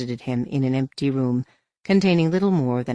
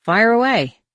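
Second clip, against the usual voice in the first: feigned nonchalance; 8 semitones higher, 8 dB louder, slower than usual. A narrator's reading. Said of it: "feigning nonchalance all the same".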